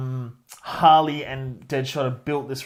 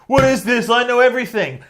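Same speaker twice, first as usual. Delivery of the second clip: higher and louder, puffed-up know-it-all; mock Ric Flair bluster; 7 semitones higher, 6 dB louder than usual. Ric Flair boasting about his files, loud mock bluster. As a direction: bark it like a know-it-all showing off. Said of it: "Ric Flair's like".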